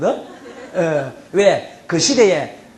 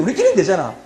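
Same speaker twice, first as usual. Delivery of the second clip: usual speaking voice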